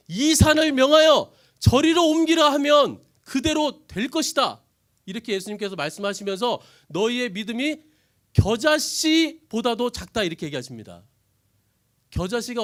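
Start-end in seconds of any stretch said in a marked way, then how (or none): 0.1-4.6 loud, high, slow command; commanding proclamation. Acted. Preacher declaring Jesus' words, loud command. 6.9-10.2 loud, very slow scolding; stern rebuke. Acted. Jesus scolding the disciples, slow loud rebuke.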